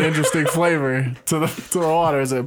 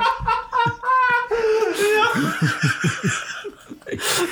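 high, wheezing burst of laughter; shrieking laughter. Real, not acted. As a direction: laugh until you cry. Hysterical laughter.

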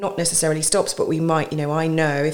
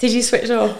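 laughing teasing question; giggling as he admits he zoned out; 12 semitones higher, 6 dB louder than usual. Real, not acted. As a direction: tease him through a laugh. Teasing laugh at distracted host.

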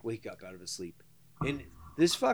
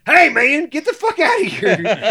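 much higher and louder, half laughing; yelling at the bear; 12 semitones higher, 14 dB louder than usual. Retold or imitated. Man in bed yells at a bear clawing the screen, laughing shout. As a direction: shout it right at the window. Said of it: "And I'm like".

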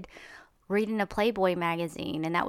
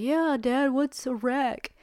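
put-on higher, childlike kid voice, slow on the question; little kid voice; 6 semitones higher, slower than usual. Retelling the movie trailer, the little kid asks his dad in a higher kid voice. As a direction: play the innocent little boy asking his dad. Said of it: "And he's like".

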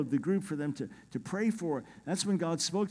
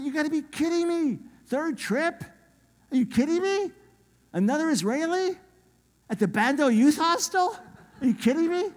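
very high, loud re-enacted disbelief; incredulous amazement; 11 semitones higher, 6 dB louder than usual. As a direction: exclaim each question in soaring astonishment. Retold amazement at third coincidence.

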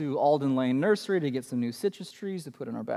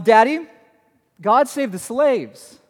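put-on higher, slow little-girl voice, lightly done; imitating his daughter; 6 semitones higher, 7 dB louder than usual. Dad imitating his preschooler in the minivan, higher and slow. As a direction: play a four-year-old proudly announcing. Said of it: "my daughter says".

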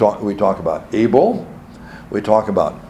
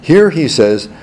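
usual speaking voice

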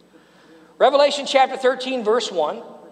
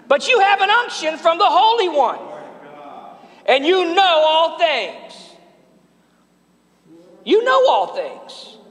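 preaching at full force; 8 semitones higher than usual. Acted. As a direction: proclaim it like good news. Preacher proclaiming the verse.

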